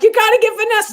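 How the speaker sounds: high, eager push; a lively mock plea; excited insistence. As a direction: insist she has to be booked. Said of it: "That's why I was like".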